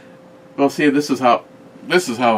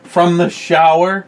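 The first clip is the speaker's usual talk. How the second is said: higher, louder, slow disbelieving question; can't believe she'd ask that; drawn-out indignant rise; incredulous; 9 semitones higher, 8 dB louder, slower than usual. Real, not acted. Bickering about the phone.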